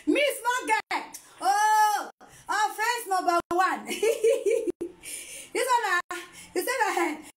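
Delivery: high-pitched exclaiming broken by giggles; amused disbelief. Real, not acted. Mom reacts to small-girl comment, laughing disbelief.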